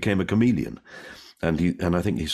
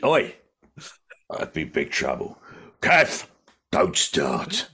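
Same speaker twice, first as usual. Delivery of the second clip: put-on Cockney with raised call-outs; EastEnders London accent; 3 semitones lower, slower than usual. Old EastEnders bloke warns Kev, put-on London accent. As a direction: play an old Cockney bloke warning someone. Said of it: "don't genuinely have that accent".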